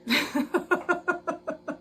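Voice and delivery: a long, uncontrollable laugh; laughing too hard to stop; helpless laughter. Real, not acted. Sewer at her machine cracking up at her own fear, helpless laughter. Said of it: "I can't stop laughing".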